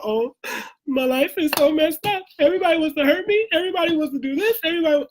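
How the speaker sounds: mock a self-pitying victim, squeaky and rushed; mock whining. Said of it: "talking about".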